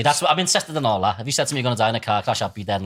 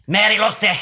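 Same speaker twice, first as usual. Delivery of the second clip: higher-pitched mock possessed medium; Derek Acorah; 6 semitones higher, 3 dB louder than usual. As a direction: blurt it as a sham psychic. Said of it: "It was all that, right?".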